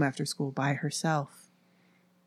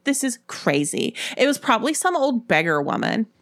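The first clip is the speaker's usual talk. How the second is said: higher-pitched irritated protest; exasperated; 7 semitones higher than usual. A narrator's reading. Sybil explaining away the ghost, irritated. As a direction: dismiss it with a touch of anger. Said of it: "Sybil exclaimed angrily".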